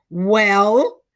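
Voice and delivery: loud stiff demand; sharp demand. Acted. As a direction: snap it out stiffly. Stiff old lady demands.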